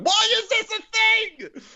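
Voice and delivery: high, loud outburst of disbelief; exasperated shouting. Retold or imitated. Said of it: "I'm just shouting at the microphone".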